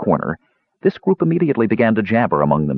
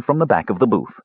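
ordinary delivery, nothing marked

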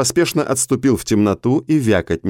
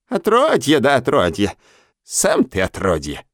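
put-on gleeful old hag; 2 semitones higher than usual. A narrator's reading. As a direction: croon it like a witch. Old hag Karga speaks. (медленно, голосом старой карги)

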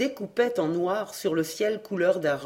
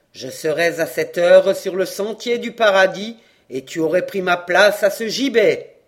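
sepulchral howling voice; 8 dB louder than usual. Acted. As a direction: howl the curse from the gallows. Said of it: "une voix sépulcrale hurla".

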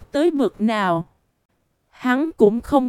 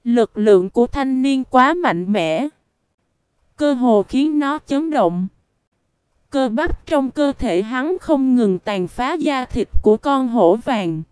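ordinary delivery, nothing marked

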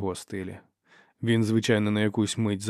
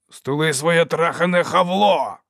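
loud, high, drawn-out angry roar; furious snarl; 7 semitones higher, 7 dB louder, slower than usual. A narrator's reading. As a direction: snarl it to silence him. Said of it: "загарчав Доу". (люто й гучно загарчати)

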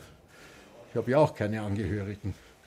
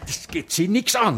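curt snap; 9 semitones higher than usual. Acted. Snaps at nurse.

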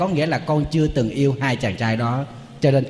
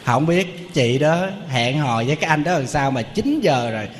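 ordinary talk, nothing marked

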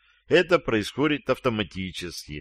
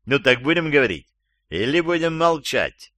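higher, insistent questioning; pressing interrogation; 5 semitones higher than usual. Acted. Captain questions gagged captive again, pressing and insistent. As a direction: push the prisoner for an answer.

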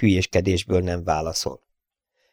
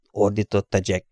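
usual speaking voice

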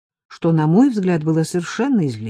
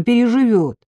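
breezy, higher-pitched dismissal; carefree; 6 semitones higher, 4 dB louder than usual. A narrator's reading. Narrator dismissing the employer's feelings, carefree. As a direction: shrug it off lightly. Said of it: "сказала я беспечно".

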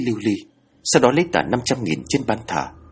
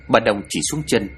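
usual speaking voice

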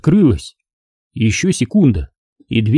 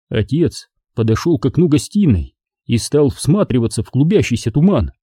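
usual speaking voice

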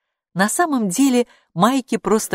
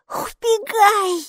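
put-on urgent girl voice; 4 dB louder, much slower than usual. A narrator's reading. Girl urges creature away.

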